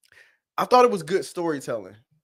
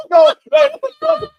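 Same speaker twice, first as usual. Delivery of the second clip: high, loud laughing protest; 10 dB louder than usual. Real, not acted. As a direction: wheeze no through laughter. Overcome by laughter.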